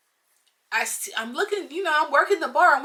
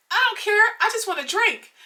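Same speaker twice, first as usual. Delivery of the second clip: high-pitched imitation of a dismissive guy; mock customer; 9 semitones higher than usual. Customer brushing off talk of his wife, mock high voice.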